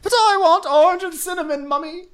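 put-on very high, loud babyish wail; mock whining child. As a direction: whine hammily like a brat begging mother. Mocking knight as whining child.

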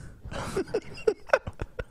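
squealing burst of laughter; high breathless laugh. Real, not acted. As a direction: crack up at the words.

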